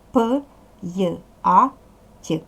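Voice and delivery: П, й, а, т as separate sounds in a row; sounding out sounds. Shown as a demonstration. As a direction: break the word into its sounds П й а т. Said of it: "Після апострофа я позначає два звуки". (вимовити П, й, а, т окремими звуками)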